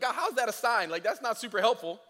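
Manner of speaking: high, loud doubtful; unimpressed questioning; skeptical. Retold or imitated. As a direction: Moses doubting God's sign.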